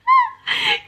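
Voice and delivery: a sudden high sob-laugh breaking out; high crying laugh. Real, not acted. Mum reliving the birth breaks into a teary laugh. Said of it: "I'm so traumatised".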